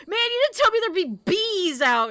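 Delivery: put-on loud, high grumble of a kid stomping off; mock a furious child complaining on the way out; pissed-off child. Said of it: "the kid is just stomping off".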